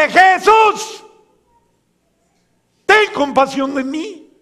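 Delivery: high, loud, drawn-out plea. Acted. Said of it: "grita más fuerte".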